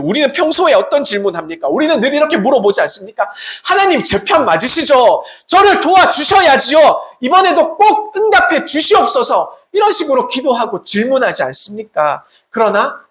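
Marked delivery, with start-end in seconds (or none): 3.6-9.6 high, insistent mock prayer; pleading prayer. Believer asking God to take their side, pleading. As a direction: act out an urgent plea to God. Acts out people's usual prayer.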